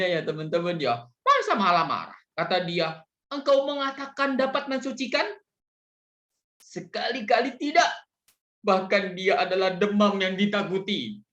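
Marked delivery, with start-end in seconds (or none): 3.3-11.2 high-pitched indignant protest; hammed-up angry Bedouin shouting back. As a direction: play the offended Bedouin, voice rising in protest.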